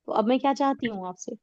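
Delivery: ordinary talk, nothing marked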